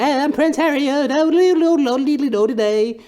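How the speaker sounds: very high, loud, silly posh British voice; goofy high-pitched mock British royal; Prince Harry impression. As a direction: ham up a squeaky toff prince. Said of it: "I'm like".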